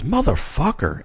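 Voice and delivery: mildly exasperated, muttered to himself; retold frustrated curse. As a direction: drag out the curse in muted exasperation. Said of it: "Like, just frustrated".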